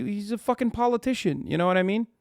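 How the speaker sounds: normal talking voice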